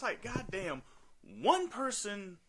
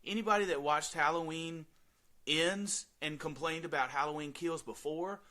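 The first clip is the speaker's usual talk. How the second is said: ordinary talk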